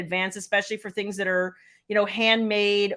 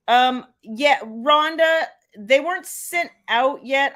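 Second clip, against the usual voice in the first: ordinary talk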